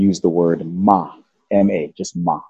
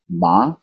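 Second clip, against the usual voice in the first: "ma" rising like a question; questioning, upward "ma"; second tone; 3 semitones lower than usual. Shown as a demonstration. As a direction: lift "ma" up at the end. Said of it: "lift up at the end of your voice".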